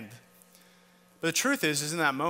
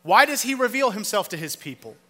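rising preaching; 6 semitones higher, 7 dB louder than usual. Acted. Preaching builds to loud climax.

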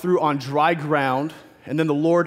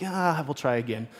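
resigned mock sigh; 3 semitones lower, 7 dB quieter than usual. Imagines being a fed-up God.

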